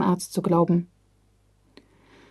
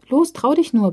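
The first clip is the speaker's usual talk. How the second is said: encouraging; 6 semitones higher than usual. A narrator's reading. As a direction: coax a shy sister to try. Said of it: "bestärkt sie die Schwester".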